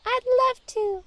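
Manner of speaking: very high, bright burst of joy; the mouse gladly accepts the princess's invitation, overjoyed. Acted. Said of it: "The mouse was overjoyed with excitement".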